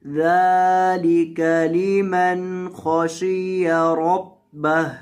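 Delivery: slow, melodic tilawat chant; Quran recitation. Acted. Teacher reciting the verse to students, slow and melodic. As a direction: draw out each word in measured recitation.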